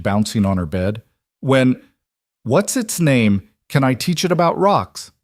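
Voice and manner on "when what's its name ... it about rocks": put-on high, eager child's questions; excited child. A narrator's reading. Lily asking about the new baby, excited. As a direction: pitch rising with excitement, question after question.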